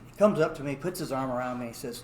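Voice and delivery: normal talking voice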